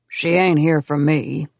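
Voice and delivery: put-on low, slow grumbling old man; grumpy old man. A narrator's reading. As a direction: grumble like a stubborn grandpa. Grandpa Mo speaks.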